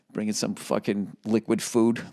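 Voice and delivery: normal talking voice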